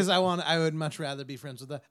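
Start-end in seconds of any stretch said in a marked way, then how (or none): none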